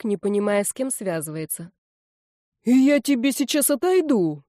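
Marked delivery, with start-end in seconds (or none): 2.6-4.4 put-on furious old woman's yell, louder and higher, "отойду" hit hard; bellowing in bass. A narrator's reading. Old woman yelling at a man who spat on her floor. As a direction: roar "Я тебе сейчас отойду" at him, "отойду" falling hard. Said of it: "заорала басом Фроловна".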